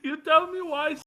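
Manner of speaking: stubborn mock Indian accent. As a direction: keep pushing in a put-on Indian accent. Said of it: "No one finds it funny".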